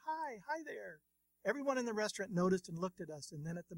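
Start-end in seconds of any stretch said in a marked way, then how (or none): 0.0-1.0 put-on high-pitched baby greeting, read by a narrator; storyteller's high little baby voice; laughing baby's answer. A narrator's reading. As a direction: voice a happy baby, pitched well up. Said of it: "Eric continued to laugh and answer".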